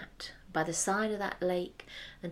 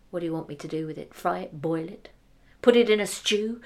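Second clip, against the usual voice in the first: angry; 3 semitones lower, 5 dB louder, faster than usual. Acted. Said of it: "She was so angry".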